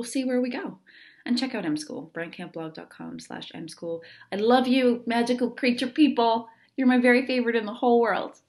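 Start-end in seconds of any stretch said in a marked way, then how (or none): 4.3-8.4 louder and higher, fast and beaming; gushing sign-off. Real, not acted. Host gushing love at her listeners, louder and higher. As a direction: burst out with affection.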